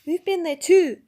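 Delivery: loud, sharp retort; snapped. A narrator's reading. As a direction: snap back at her. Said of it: "snapped Jill".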